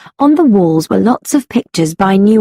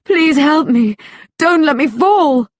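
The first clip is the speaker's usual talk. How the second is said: high, pleading cry for help; falling woman begs Catwoman for help, high and pleading; desperate plea; 6 semitones higher than usual. A narrator's reading. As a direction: beg not to be dropped.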